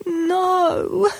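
high tearful no; sobbing. A narrator's reading. Upset girl refusing her mum, high tearful sob. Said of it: "sobbed Lucy".

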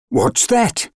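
high-pitched startled question from forest creatures; startled cry. A narrator's reading. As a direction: cry out in alarmed surprise, pitched well up. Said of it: "cried the forest creatures".

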